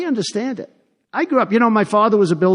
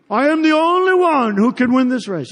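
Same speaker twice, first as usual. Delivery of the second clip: mock Shakespearean declamation, pitched higher; Cruz as Shakespeare; 4 semitones higher than usual. Candidate mocking rival at a rally, mock Shakespearean delivery. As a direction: intone it grandly like a Shakespeare actor. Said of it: "it sounds like Shakespeare".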